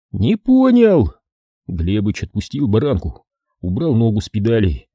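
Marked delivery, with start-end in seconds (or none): 0.1-1.1 slow, loud bewildered repeat; drawn-out confused reply; baffled drawl. A narrator's reading. Глебыч снова в растерянности. (растерянно, протяжно)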